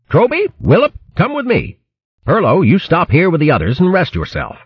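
high brisk officer voice; hare captain voice. A narrator's reading.

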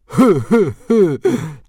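huge, high snorting laugh; loud guffaw. A narrator's reading. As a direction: burst out laughing at the joke. Dog's Breath guffaw.

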